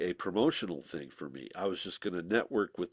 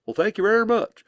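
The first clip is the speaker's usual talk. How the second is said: higher and louder, hammed-up English "thank you very much"; exaggerated English accent; 12 semitones higher, 8 dB louder than usual. [name] mimics Billy's thanks in an overdone English accent. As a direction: ham up a plummy English accent, pitched high. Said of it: "he doesn't sound like that either".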